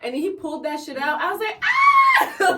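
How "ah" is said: very high, loud cartoon shriek; cartoon scream. Speaker seeing the mouse pulled out, cartoon scream. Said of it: "Like the fucking cartoon scream for mice".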